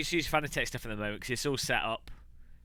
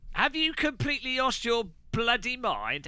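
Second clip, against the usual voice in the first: mock outrage; 10 semitones higher than usual.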